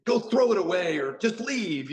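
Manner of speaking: exasperated retold order; mock mad. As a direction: imitate an angry officer sending someone off. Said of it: "he's all mad at me".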